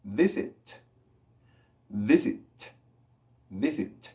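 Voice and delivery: "visit" with a released, breath-only T; careful "visit" ending in a light puff; airy T. Shown as a demonstration. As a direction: let air escape on the last T of "visit". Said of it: "El sonido de T en inglés es viento".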